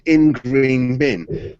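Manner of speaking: drawn-out, deliberate words; deadpan note reading. Retold or imitated. Storyteller repeating the ticket's only words, slow and deliberate. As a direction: spell out the absurd note very slowly. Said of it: "nothing else on it".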